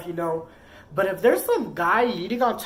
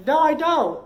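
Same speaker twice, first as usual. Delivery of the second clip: loud, high retold protest; indignant denial; 8 semitones higher, 8 dB louder than usual. Partner accused of having somebody at the house, indignant denial. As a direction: reenact firing back at a false accusation.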